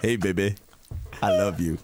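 deep crooning come-on; sleazy lover voice. Retold or imitated. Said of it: "That's how I did it".

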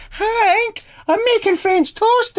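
very high, screechy put-on wife voice; guitarist's wife. Wife calling her husband to breakfast, very high mock call. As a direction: call through the house in falsetto. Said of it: "She's like".

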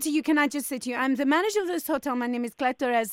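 mock Spanish hotel manager, polite but firm; fake Spanish accent. Prank hotel manager to angry caller, in a fake Spanish accent. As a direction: put on a Spanish accent and keep it courteous.

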